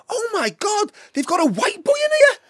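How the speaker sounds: put-on high, loud, startled girl's cry; astonished young girl. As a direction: squeal it like a girl spotting something unbelievable. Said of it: "this young girl ... she went".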